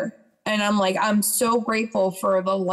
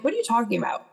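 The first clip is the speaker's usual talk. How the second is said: ordinary talk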